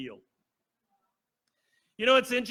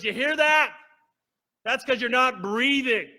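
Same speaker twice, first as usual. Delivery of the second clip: loud and much higher, urgent; nurse scolding; 6 semitones higher, 8 dB louder than usual. Retold or imitated. Nurse warning the patient he stopped breathing, loud and high. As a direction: jolt him awake with alarm.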